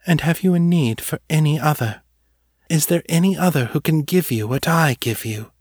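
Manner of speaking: otherworldly muse, lightly voiced; possessive muse. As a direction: taunt him with what only she gives. The muse claims him.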